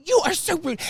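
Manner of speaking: a sneering, high-pitched retort; high mock scold. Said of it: "And she starts".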